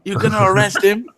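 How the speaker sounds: high-pitched mock outrage; Zarna Joshi. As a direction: imitate an indignant woman way up high. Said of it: "you can just hear Zarna Joshi shouting".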